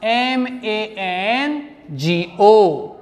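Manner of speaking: letters spelt out slowly one by one; teacher spelling mango slowly. Shown as a demonstration. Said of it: "spelling out the word".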